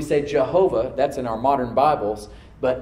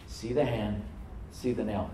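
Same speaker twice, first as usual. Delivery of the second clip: solemn refrain; 3 semitones lower, 8 dB quieter than usual. Acted.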